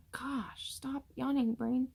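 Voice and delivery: soft plea. Real, not acted. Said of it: "Five times".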